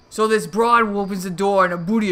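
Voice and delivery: loud hammed-up pulp gangster; tough-guy noir voice. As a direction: ham up a hardboiled tough guy. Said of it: "Is that better?".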